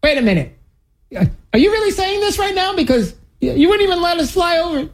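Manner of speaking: mock-indignant high-pitched objection; indignant disbelief. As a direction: pretend you can't believe what you're hearing. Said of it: "I would be like".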